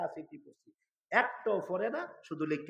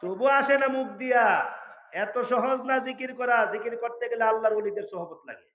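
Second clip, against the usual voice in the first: tearful preaching; 8 semitones higher, 9 dB louder than usual. Real, not acted. Preacher lamenting to the gathering that dhikr is hard, tearful. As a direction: cry out the lament to the crowd. Weeping over difficulty of dhikr.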